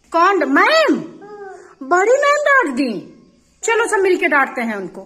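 put-on loud, indignant disbelief on a child's behalf; mock outrage. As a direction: act shocked and loudly take the child's side. (नकली गुस्से में ज़ोर से)

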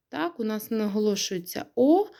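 ordinary talk

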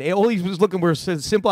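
normal talking voice

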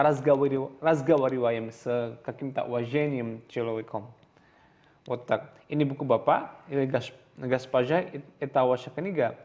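normal talking voice

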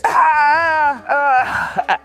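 long high-pitched cry; high sobbing wail. Acted. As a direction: wail wordlessly in despair. Crying over his ex.

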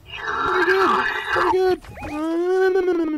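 very high, slow squeak; squeaky high voice. Pretend. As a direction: squeak it out like a cartoon. Eating minis, squeaky voice.

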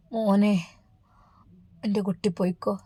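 put-on a sick old father barely managing to speak; words squeezed out, faint; weak struggling voice. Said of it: "എങ്ങനെയൊക്കെയോ പറഞ്ഞൊപ്പിച്ചു".

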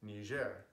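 Niger said as nee-ZHAIR; French pronunciation. Shown as a demonstration. Natives correcting an English speaker, French pronunciation. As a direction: say Niger the French way, stress on the end. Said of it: "it is pronounced".

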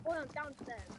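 soft distant echo of the call; faint echo. Pretend. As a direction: sound far off, like an echo.

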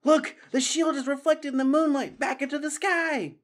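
put-on a young mouse shouting with wonder; Matthias crying out. Matthias calling friends to the shining shield, high excited cry. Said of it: "Matthias was first to cry out".

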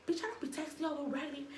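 mimicking boyfriend. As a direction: toss it off as him, mocking. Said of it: "He said".

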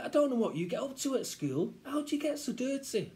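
high, scolding mother voice; exasperated mum. Acted. Mum scolds child for getting dirty, exasperated. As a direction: despair at a grubby child. Mum nags about dirt.

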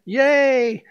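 game show host. Pretend. Preacher congratulating Peter like a quiz show host, playful.

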